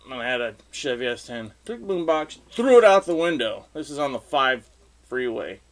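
normal talking voice

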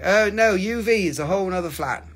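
ordinary delivery

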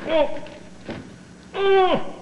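strained grunt. Acted. Lifting heavy Juliet down.

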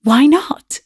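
eager cry at an exciting idea; delighted, lifted exclamation; cried out. A narrator's reading. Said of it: "cried Constantia".

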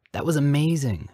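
high, thrilled exclamation; amazed excitement. A narrator's reading. Boy has just seen prayer answered, amazed. Harry marvels at the miracle.

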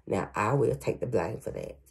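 normal talking voice

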